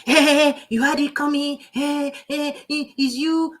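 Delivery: lightly voiced, mocking self-justifying jab; defiant retort. People defending their attitude, mock defiant retort. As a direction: toss it back as a taunt. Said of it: "Some people will still say".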